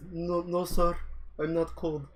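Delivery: high, small voice of a shivering recruit denying the cold; meek recruit. Retold or imitated. Recruit Muhammad insists to the drill instructors he isn't cold, high and meek. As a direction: squeak it out, trying hard to sound fine.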